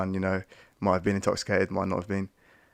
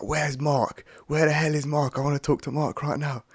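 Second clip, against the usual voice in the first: higher, pushy demand for Mark, mildly acted; angry girl demanding; 6 semitones higher than usual. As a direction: play the irate girl at the door, raised pitch. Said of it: "she's like".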